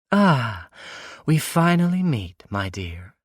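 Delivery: high, loud mock-sweet villainess greeting; sinister woman. Captor woman greeting James, mocking sweet villainess. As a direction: purr a predatory welcome, rising high.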